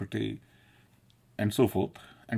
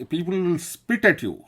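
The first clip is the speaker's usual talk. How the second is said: higher and louder, half-shouted fatherly warning; alarmed dad, pitched up and loud; retold father's warning; 8 semitones higher, 3 dB louder than usual. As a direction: warn the boy like an alarmed father, raised voice. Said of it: "my daddy was saying".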